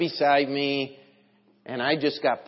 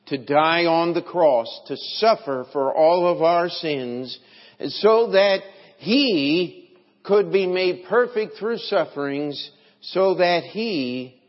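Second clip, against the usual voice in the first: loud, building pulpit delivery; forceful preaching; 5 semitones higher, 7 dB louder, slower than usual. Real, not acted. Preacher tells congregation of the cross, forceful preaching. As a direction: drive each phrase home with raised volume.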